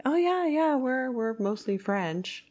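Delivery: high start easing down, lightly imitated; lightly voiced offhand assurance; family's breezy claim. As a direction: imitate relatives breezily sure of themselves. Voicing the family's confident claim.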